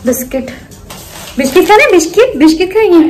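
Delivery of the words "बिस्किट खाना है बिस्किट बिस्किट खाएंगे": higher, louder sing-song voice to the pets; pet talk. Real, not acted. Offering biscuits to her pets, high excited pet talk. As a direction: coax the animals like babies.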